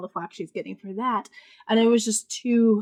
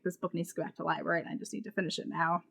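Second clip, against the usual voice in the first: quick and softer, talking to herself; inner voice; 4 semitones lower, 11 dB quieter, faster than usual. Retold or imitated. Reviewer recalling her own thought about returning the library book, said softly and fast. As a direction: rush it under your breath like a private thought. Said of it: "I was like".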